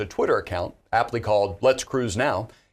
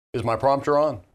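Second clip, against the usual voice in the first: normal talking voice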